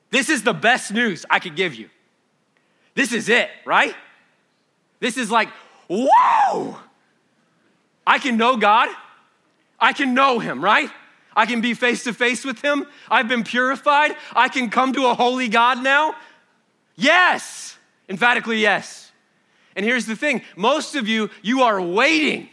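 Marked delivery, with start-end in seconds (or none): 0.1-5.5 loud, high, rising joy of good news; preaching at full force. Acted. Preacher shouting good news to his congregation, jubilant and loud. 5.9-6.8 soaring high exclaimed whoa; awed whoa. Acted. Preacher marvelling at knowing God, a soaring whoa. 8.1-19.1 loud, high, rising joy of good news; preaching at full force. Acted. Preacher shouting good news to his congregation, jubilant and loud.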